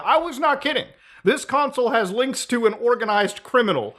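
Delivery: ordinary talk, nothing marked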